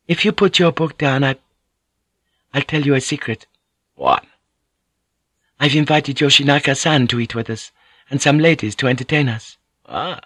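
a courteous lady explaining sweetly; Mariko, gently teasing. Acted. Narrator voices Mariko.

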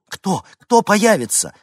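high, agitated question; alarmed questioning. Acted. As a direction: ask in rising alarm. Испуганная женщина не понимает. (встревоженно, высоким голосом)

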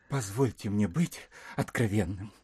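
usual speaking voice

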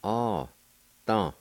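"ah, da" in two separate beats; Cherokee syllables. Shown as a demonstration. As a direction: say "ah" then "da" with a pause between. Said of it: "The second part".

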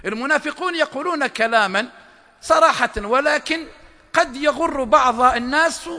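ordinary talk

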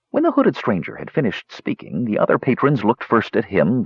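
usual speaking voice

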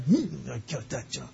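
restrained glee. Pretend. New hire walking out, quietly hiding excitement. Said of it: "trying to keep it all together".

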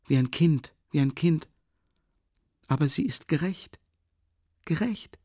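pleading with pity. Acted. Sonja pleads with pity for her sick stepmother. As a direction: defend her with aching compassion. Sonja's long anguished plea.

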